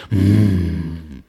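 low soothing hum; calming grunt. Shown as a demonstration. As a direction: hum low to calm a gorilla. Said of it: "Und dann musst du einfach nur machen". (beruhigendes tiefes Brummen)

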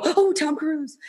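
high-pitched delighted "oh"; excited fan reaction. Retold or imitated. Listener reacting to a podcast's Tom Cruise topic, excited. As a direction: imitate your own eager reaction, voice lifted.

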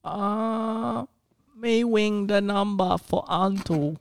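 high, slow silly cartoon voice; Elmer Fudd voice. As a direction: talk like a dim cartoon hunter. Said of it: "like, Elmer Fudd".

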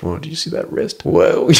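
hushed, amazed whisper; awed whisper. Retold or imitated.

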